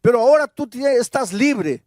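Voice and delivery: fervent pastor proclaiming forgiveness; full-force preaching. Acted. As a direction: preach it out to the congregation, building.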